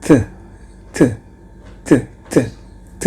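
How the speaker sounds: "T" as a breathy th with the tongue between the teeth; voiceless th sound; voiceless th drilled. Shown as a demonstration. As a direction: blow air over the tongue on "T".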